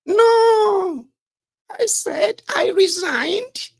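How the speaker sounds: very high, loud falsetto, drawn out; mock lying minister. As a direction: squeal a slow, protesting denial like a lying minister. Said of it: "Did you hear that voice?".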